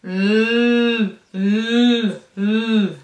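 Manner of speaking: l held long and loud; prolonged l; long hissing l. Shown as a demonstration. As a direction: stretch the l sound like a slug. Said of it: "le bruit de la limace, c'est".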